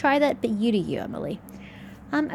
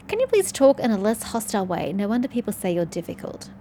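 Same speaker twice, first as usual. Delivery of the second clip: normal talking voice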